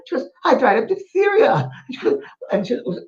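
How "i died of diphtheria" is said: put-on high small child's voice, matter-of-fact; little girl voice. Medium voicing a spirit child in a seance tape, light child voice. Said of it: "a wee little one".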